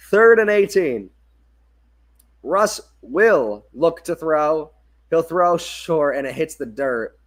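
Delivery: punchy loud down call, then slow build to the throw; play-by-play call. Acted. Announcing third and long to viewers, tense play-by-play. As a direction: hit the down hard, then stretch the throw.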